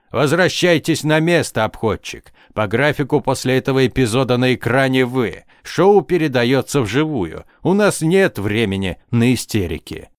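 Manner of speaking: plain reading voice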